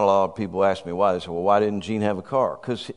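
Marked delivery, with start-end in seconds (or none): none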